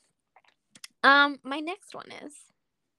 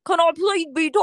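louder, hammed-up wandering British accent; muddled accent; 3 semitones higher, 5 dB louder than usual. Friend retrying her plea to be Tom, muddled British accent. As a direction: overdo a fake accent that goes astray. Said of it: "what is this accent?".

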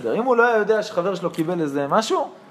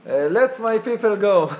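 put-on slow, loud American-accented English; 5 semitones higher, 4 dB louder, much slower than usual. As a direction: drawl it like an American tourist. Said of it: "עם מבטא אמריקאי".

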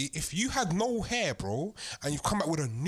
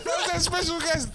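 laughing; 10 semitones higher, slower than usual. Real, not acted. Laughing joke.

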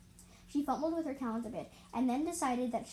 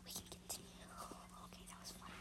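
whisper; 10 dB quieter than usual. Real, not acted. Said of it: "Sorry for the disturbance".

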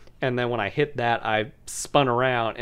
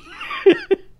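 very high laughing burst; high-pitched cackle; shrieking laugh; 6 dB louder than usual. Real, not acted. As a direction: let a squeal of laughter out. Laughing at motor skills joke.

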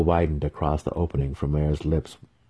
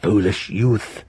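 cackled; 2 semitones higher, slower than usual. A narrator's reading. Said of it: "the faceless one cackled".

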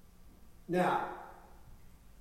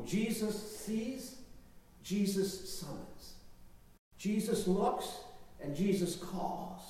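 slow, weighty; 7 dB quieter, slower than usual. Acted. Preacher slows for dramatic effect.

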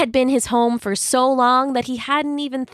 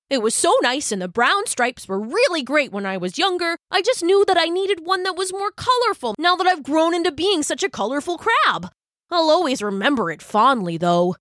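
high, swooping storybook crab voice; enthusiastic Brock character; 8 semitones higher than usual. A narrator's reading. Colorful crab fondly remembering his old shell, gushing. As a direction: gush about it in a raised, lilting character voice.

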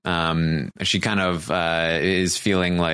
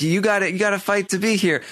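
high, fast rallying cry; hyped mock urging; 12 semitones higher, faster than usual. Podcaster voicing Kevin telling Bailey to fight, high and fast. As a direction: push her on, quick and hyped.

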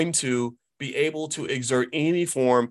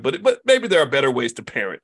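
normal talking voice

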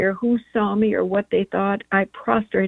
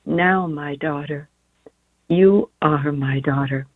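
whispered, low and slow; 6 semitones lower, slower than usual. Retold or imitated. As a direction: breathe it tenderly as a whisper. Our Lady speaking to her.

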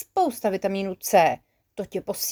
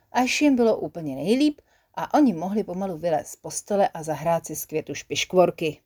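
usual speaking voice

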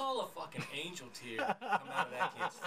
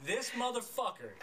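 ordinary talk

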